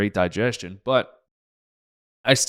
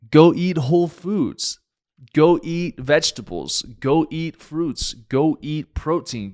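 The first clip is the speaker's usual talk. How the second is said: emphatic lecture; 6 semitones higher, 5 dB louder, slower than usual. Retold or imitated. Doctor lecturing parents on diet.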